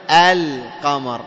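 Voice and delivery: "القمر" as al... qamar, the l held; lam clearly pronounced. Shown as a demonstration. Teacher showing a moon letter, clearly sounded lam in "القمر". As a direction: sound the lam fully in "القمر" before the qaf. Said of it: "نطقنا اللام".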